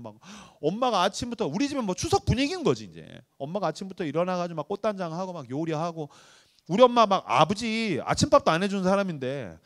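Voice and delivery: ordinary delivery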